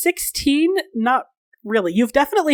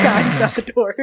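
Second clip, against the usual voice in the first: ordinary talk, nothing marked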